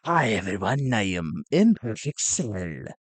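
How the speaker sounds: low villain voice; Imperfect Cell. Retold or imitated. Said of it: "for the, uh, chairman".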